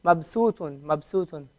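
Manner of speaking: "Mabsutun" joined smoothly with no vowel after b; "Mabsutun" as one word, b closed; blended in one. Shown as a demonstration. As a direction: run "Mab" straight into "sutun". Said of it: "What does it sound like?".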